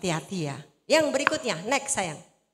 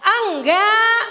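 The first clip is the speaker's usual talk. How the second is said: high, very loud drawn-out ENGGAK; big exaggerated teaching shout; demonstrating loud response; 8 semitones higher, 12 dB louder than usual. Shown as a demonstration. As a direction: belt out "enggak" for the crowd to copy. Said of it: "Ayo saya ajarin ngomong".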